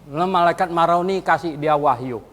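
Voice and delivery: normal talking voice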